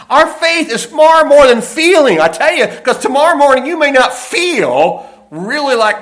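fervent preaching. Acted. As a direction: preach it hard. Preacher rises to full force.

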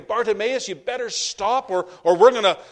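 acted rebuke, high and forceful; scolding crowd warning. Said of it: "It was like they were telling him".

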